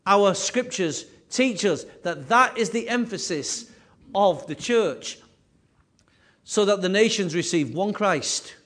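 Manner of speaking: ordinary talk, nothing marked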